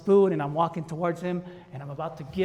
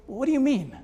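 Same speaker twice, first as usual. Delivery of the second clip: baffled; 8 semitones higher than usual. Retold or imitated. Baffled husband questions wife.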